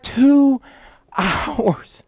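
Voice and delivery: "Two hours" stretched, with a pause between the words; "Two... hours", slow and spaced for disbelief; drawn-out emphasis. Real, not acted. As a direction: draw out "Two", pause, then drop softly on "hours". Stressing how long it lasted.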